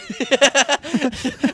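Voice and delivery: loud, high cackling laugh; laughing out loud at the bit; high burst of laughter. Real, not acted. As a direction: crack up hard.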